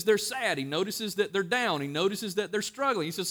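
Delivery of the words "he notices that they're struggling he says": usual speaking voice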